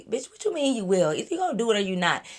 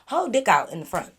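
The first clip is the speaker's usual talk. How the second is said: ordinary talk, nothing marked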